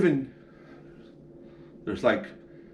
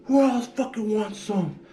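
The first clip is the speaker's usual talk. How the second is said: hyped-up challenge; 11 semitones higher than usual. Retold or imitated. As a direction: dare everyone to step up. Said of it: "he was so hyped".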